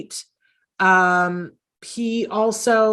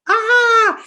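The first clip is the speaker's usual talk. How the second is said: mock dismay; 7 dB louder than usual. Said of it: "and I'm like".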